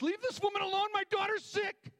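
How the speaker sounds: a father frantic for his sick daughter, shouting. Retold or imitated. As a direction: yell it at the crowd in a panic. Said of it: "Jairus must have been going crazy".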